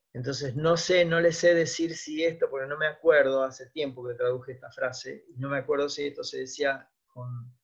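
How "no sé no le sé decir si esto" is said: ordinary talk, nothing marked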